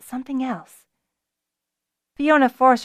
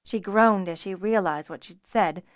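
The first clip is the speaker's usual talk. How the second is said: ordinary delivery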